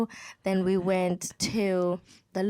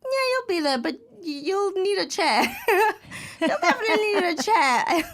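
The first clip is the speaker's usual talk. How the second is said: high, amused voice of the doctor, laughing through it; doctor's cheerful warning; 6 semitones higher than usual. Retold or imitated. Doctor telling her it will hurt, high and laughing. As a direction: play the doctor breezily breaking bad news. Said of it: "my doctor was like".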